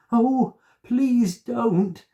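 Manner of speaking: high, loud, slow desperate plea; pleading cry. A narrator's reading. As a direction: beg him, terrified. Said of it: "cried Molly".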